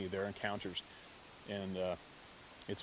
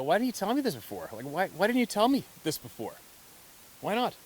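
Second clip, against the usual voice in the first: high, loud, insistent disbelief; almost shouting why not; incredulous pleading; 9 semitones higher, 5 dB louder than usual. Retold or imitated. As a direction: demand to know why he kept it secret. Pressing grandfather, incredulous questions.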